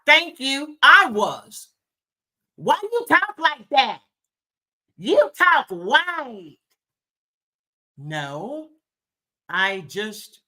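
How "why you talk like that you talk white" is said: high, slow jeering schoolkid voice; a child mocking how she talks; mocking kids' taunt. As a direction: sneer it like a playground bully. Bullies' taunt retold from childhood.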